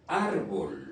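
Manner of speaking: "árbol" stressed on ÁR, falling away on bol; stress on first syllable. Shown as a demonstration. Teacher shows where the accent falls, first-syllable stress on "árbol". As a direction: lean on the ÁR of "árbol". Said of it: "la syllabe en espagnol où l'on fait plus de force".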